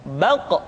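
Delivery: "Baq" with the final q bouncing off audibly; echoed qaf. Shown as a demonstration. Teacher demonstrating sukun, bounced "Baq". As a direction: say "Baq" and release the uvular q with a short bounce. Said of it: "terdengar pantulan suara".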